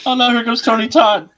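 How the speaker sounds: high, loud mock alarm; mock panic. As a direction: yelp it out in fake dread.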